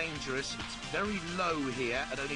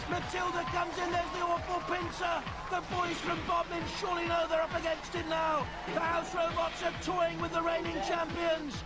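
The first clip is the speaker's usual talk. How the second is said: higher, steady sports commentary; live play-by-play; 10 semitones higher than usual. Real, not acted. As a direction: keep the live call going.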